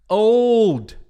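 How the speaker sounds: put-on "old" più alto e forte, con L e D nette; corrected pronunciation. Shown as a demonstration.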